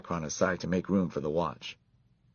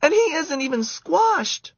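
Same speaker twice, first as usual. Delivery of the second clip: put-on high, loud squealing girl; 6 dB louder than usual. A narrator's reading. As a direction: shriek it like a startled camper. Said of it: "squealed Karen".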